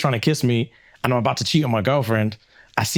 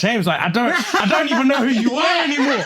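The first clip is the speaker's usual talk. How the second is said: hurt friend; 12 semitones higher than usual. Retold or imitated. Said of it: "And he's like".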